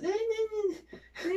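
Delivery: high, thin mock whimper; drawn-out high-pitched fake whine; mock whining. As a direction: whine in imitation of a complainer. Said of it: "aunque tú digas".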